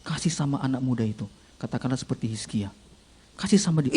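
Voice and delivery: soft, low instruction; commanding inner voice. Acted. Inner voice directing envelope to the young man, soft and low. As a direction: give the order quietly and low. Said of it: "suara itu bilang begini".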